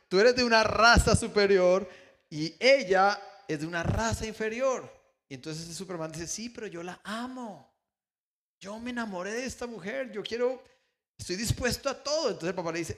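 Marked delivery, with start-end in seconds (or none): 0.1-4.9 loud, higher, lecturing father voice; father's loud rebuke. Retold or imitated. Father lecturing Superman about races, loud and higher. 6.3-12.3 earnest plea. Retold or imitated. Superman pleading with his father for the woman, higher and rising.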